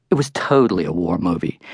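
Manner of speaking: ordinary delivery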